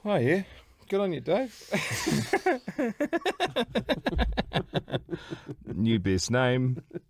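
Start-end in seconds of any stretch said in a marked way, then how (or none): 1.7-5.7 drawn-out high wheezing laugh; real giggling fit; long high laugh. Real, not acted. Laughing at Dave.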